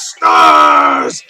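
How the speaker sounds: very low, slow, loud boom; announcer boom. Acted. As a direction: boom the name like a giant. Announcer booming team name.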